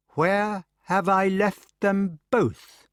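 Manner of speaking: high, very slow, mocking voice; sick son taunting his brother; mocking echo. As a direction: ape his question back. Mordred mocks, aping.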